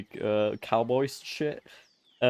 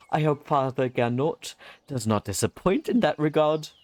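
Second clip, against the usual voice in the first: put-on higher, louder voice for a pious young monk reading his lines; earnest monk character; 6 semitones higher, 4 dB louder than usual. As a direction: play the eager monk with gentle conviction. Voices game character dialogue.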